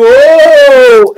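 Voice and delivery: long, loud, high "oh"; mock-startled yelp, drawn out; pilot's startled cry. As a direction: cry out mid-word as a jolt hits. Pilot cut off by turbulence.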